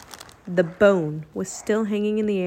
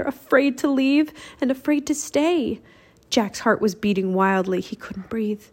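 usual speaking voice

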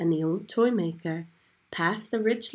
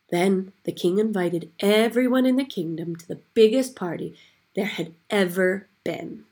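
plain reading voice

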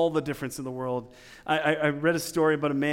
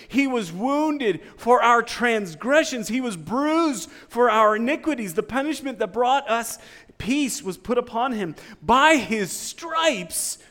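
fervent scripture reading; 8 semitones higher, 7 dB louder than usual. Acted. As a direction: proclaim each line like a prophecy. Reads Isaiah with rising fervour.

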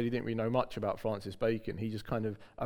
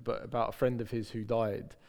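ordinary delivery

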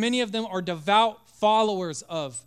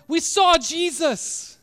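higher, louder, slow and amazed; excited disciples; 9 semitones higher, 8 dB louder, slower than usual. Acted. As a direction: insist in wonder. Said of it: "Thomas, like".

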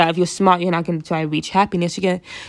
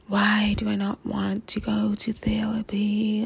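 slow, hushed mock-therapist question; breathy, unhurried imitation of a therapist; 7 dB quieter, slower than usual. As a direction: put on a soft, whispery therapy-room tone. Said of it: "I'm saying that in a therapic accent".

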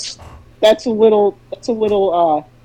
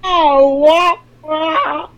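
sound effect voice; 4 semitones higher, much slower than usual. Acted.

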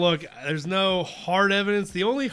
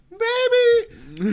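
high-pitched, louder doubtful maybe; put-on high voice of dawning doubt; mock self-doubt; 5 dB louder than usual. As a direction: pitch it way up, tentatively questioning. Mock doubt about being bad guys.

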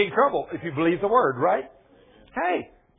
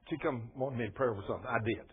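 ordinary talk

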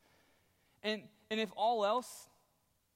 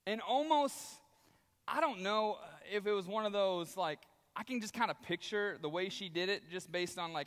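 ordinary delivery

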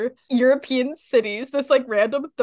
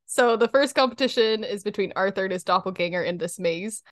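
ordinary talk

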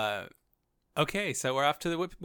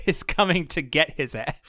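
usual speaking voice

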